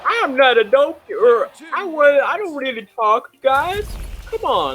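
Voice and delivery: high, loud comic protest; protesting donkey character. Retold or imitated. Acted Donkey refusing to talk.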